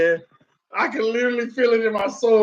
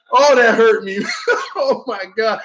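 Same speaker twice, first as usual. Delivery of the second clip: ordinary talk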